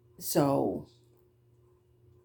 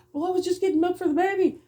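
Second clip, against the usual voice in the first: much higher, fast whiny excuse voice; mock parent excuse; 14 semitones higher, 4 dB louder, faster than usual. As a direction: mimic the careless parent making excuses. Mocking a careless parent's excuse.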